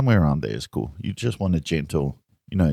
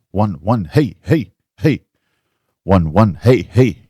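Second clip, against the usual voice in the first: loud mic check; 3 semitones higher, 8 dB louder, slower than usual. Shown as a demonstration.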